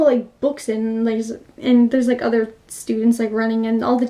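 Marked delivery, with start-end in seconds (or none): none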